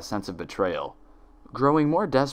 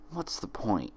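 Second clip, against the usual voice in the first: despondent retort; 9 dB quieter than usual. Acted. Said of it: "she fired back".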